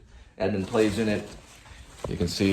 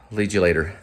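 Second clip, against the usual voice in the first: normal talking voice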